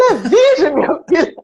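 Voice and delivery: very high, loud, laughing through it; laughing priest imitation. Priest stressing to Zezé the man is a virgin, laughing. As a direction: crack up while mimicking the priest.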